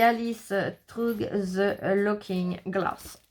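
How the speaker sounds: very slow English with French accent; laboured French-accented English title. Real, not acted. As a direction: drag out each English word, French accent. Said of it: "mon accent anglais".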